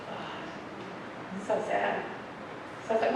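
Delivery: whispered, very soft fake sympathy; mock pity. Mocking someone who just says so sad, in a whisper. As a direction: breathe it out like hollow pity. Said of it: "you've seen someone say".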